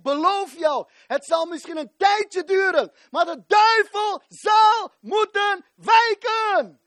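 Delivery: rising from firm to a full high shout; climbing into a fervent shout, slow at the peak; building preaching shout. Real, not acted. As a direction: preach it up to a roar.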